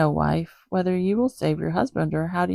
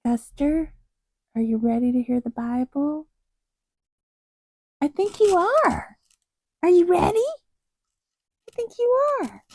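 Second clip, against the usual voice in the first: much higher, cooing kitty voice; 10 semitones higher than usual. Shown as a demonstration. Asking the cat in a high cooing kitty voice. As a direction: coo it sweetly like a cat voice. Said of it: "Kitty voice, take two".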